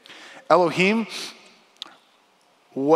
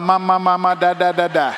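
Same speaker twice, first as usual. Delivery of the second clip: put-on baby babble; 5 dB louder than usual. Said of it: "they were still going".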